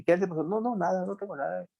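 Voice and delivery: high, soft mock denial; playing down an ailment. Someone hiding an ailment when asked, softly dismissive. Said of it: "no lo dice".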